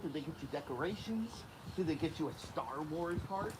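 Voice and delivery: ordinary talk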